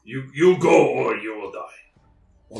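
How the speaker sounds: loud, slow creature warning; threatening bugbear. Acted. Bugbear threatening the campers, menacing creature voice. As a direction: warn them off like a hulking bugbear.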